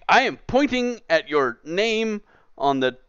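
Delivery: usual speaking voice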